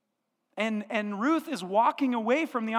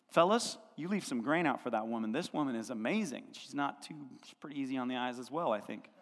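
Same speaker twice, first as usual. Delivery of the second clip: low aside; 9 semitones lower, 6 dB quieter, faster than usual. Retold or imitated. Boaz aside, low, half-confiding.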